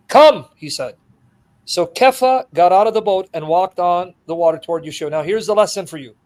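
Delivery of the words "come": loud, high one-word summons; commanding call. Acted. Jesus summons Peter onto the water, commanding. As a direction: call him over with authority.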